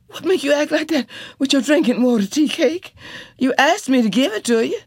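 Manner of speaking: high, fast, panicked questions; frightened wife pleading; frantic alarm. A narrator's reading. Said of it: "Janie was frantic with alarm".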